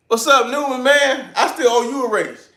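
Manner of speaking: loud, high greeting across the shop; calling out. Real, not acted. Greeting his friend Newman in the chat, a loud high call-out. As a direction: hail him loudly, high and bright.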